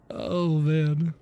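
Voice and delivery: ordinary talk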